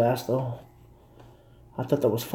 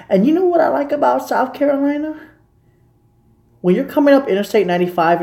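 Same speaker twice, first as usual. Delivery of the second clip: excited; 10 semitones higher, 5 dB louder than usual. Real, not acted. Excited praise of the state.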